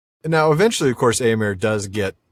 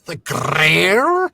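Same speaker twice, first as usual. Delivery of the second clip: rolled R echo; 5 semitones higher than usual. Retold or imitated. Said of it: "Very nice R roll".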